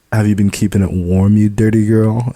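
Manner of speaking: loud playful teasing. Acted. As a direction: tease her mercilessly. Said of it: "I tease further".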